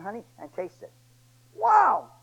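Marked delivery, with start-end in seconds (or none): 1.5-2.1 much higher, louder wow; delighted wow. Retold or imitated. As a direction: burst out with delight. Samson tastes the honey.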